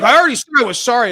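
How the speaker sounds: fast, loud, high protest; rushed, exasperated retort; defensive dad. Retold or imitated. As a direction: imitate an indignant father defending himself. Retold argument, dad defends himself.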